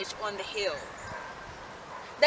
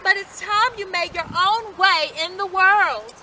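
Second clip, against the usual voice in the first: lightly voiced mother, a little higher; mother's voice; 6 semitones higher, 4 dB louder than usual. A narrator's reading. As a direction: send the pigs off kindly as mum. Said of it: "said their mother one morning".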